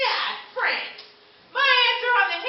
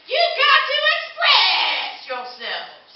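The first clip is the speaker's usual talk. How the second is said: grand urging; 5 semitones higher, 4 dB louder, much slower than usual. Acted. Urging cavemen to create.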